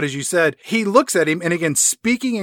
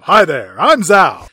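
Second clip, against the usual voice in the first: loud mock Northern Virginia greeting; punchy, hammed-up Northern Virginia accent; Zhao; 7 dB louder, slower than usual. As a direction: imitate an all-American Chesapeake Bay accent, loudly. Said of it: "the way that you said that".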